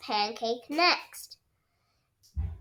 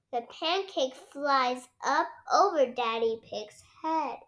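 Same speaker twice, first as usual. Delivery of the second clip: plain reading voice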